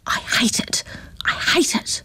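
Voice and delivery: hissed furious whisper; voiceless angry hiss into the grass. A narrator's reading. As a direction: spit it out under your breath.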